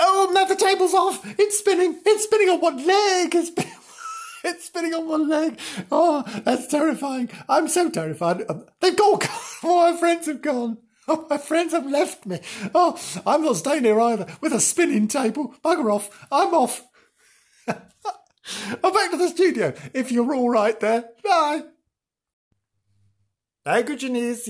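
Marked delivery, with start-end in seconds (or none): 0.0-12.4 high, loud hammed-up terror; hammed-up loud panic as friends flee; mock panic. Table spinning, mock panic. 12.7-21.6 loud, high mock flight; loud high mock panic fleeing; hammed-up exit. Fleeing the table.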